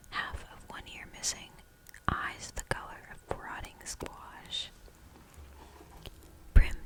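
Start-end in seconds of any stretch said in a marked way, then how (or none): none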